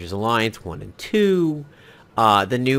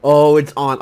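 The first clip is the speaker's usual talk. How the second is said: mock challenge; 3 semitones higher, 10 dB louder than usual. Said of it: "I'm like".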